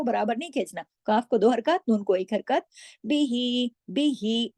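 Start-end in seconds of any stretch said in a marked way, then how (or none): 3.1-4.5 बिही with a short बि and a long ही. Shown as a demonstration. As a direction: clip बि, hold ही. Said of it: "बा एक हरकत ही दो हरकत".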